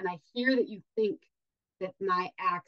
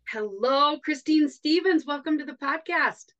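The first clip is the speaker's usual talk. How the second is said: excited greeting; 7 semitones higher, 4 dB louder than usual. Real, not acted. Host greeting guest on air.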